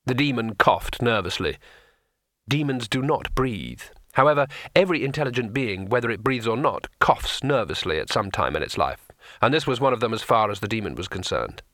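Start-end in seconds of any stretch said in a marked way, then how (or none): none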